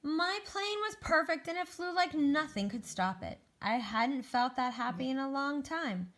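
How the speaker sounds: high, lifted, delighted read-aloud; happy storybook narration. Acted. Egg rejoices over a perfect plane, joyful storybook narration. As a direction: read it to children with soaring joy.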